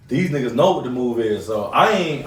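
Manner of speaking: ordinary talk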